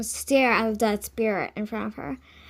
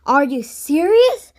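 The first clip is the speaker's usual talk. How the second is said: incredulous; 5 semitones higher, 6 dB louder, slower than usual. Acted. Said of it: "Sungha panted".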